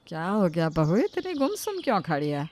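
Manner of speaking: put-on gently asking older woman; concerned mother-in-law. Mother-in-law gently asking why she stands silent.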